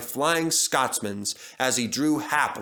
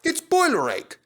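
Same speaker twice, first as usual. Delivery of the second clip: much higher, boiler stressed, falling; Duck's diagnosis; 14 semitones higher, 4 dB louder than usual. A narrator's reading. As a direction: diagnose it brightly like a know-it-all. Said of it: "put in Duck".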